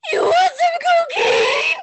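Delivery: very high, very loud scream; shrieking mock offer. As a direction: blast it into the mic. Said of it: "my ear".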